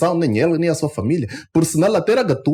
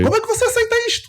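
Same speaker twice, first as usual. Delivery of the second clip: outraged; much faster than usual. Real, not acted. Outraged rhetorical question.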